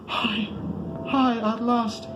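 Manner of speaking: very high, loud ecstatic shrieks; shrieking in ecstasy. A narrator's reading. Barzai shrieks from the summit as the gods laugh, high frenzied cry. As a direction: scream it out as the eclipse swallows him. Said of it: "Barzai the Wise shrieking in the shadows".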